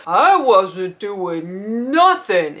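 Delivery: very slow, stretched-out denial; hammed-up dopey protest; accused drunk guy. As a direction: protest innocence with dragged words. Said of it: "this guy's like".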